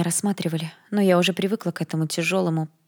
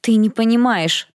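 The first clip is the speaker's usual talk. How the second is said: louder, higher, slow and insistent; frustrated outburst; 5 semitones higher, 6 dB louder, slower than usual. Acted. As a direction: stamp your foot, make him listen. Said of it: "топнула я ногой". (героиня настаивает перед принцем, раздражённо громче)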